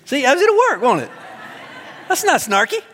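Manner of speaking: high laughing quip; giggly high teasing. Real, not acted. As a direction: tease and laugh at once. Joking after quoting Paul.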